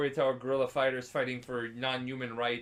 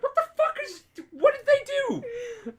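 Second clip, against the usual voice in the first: mock baffled Geralt; 5 dB louder than usual. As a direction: ham up total bewilderment. Geralt reacts, voiced by teller.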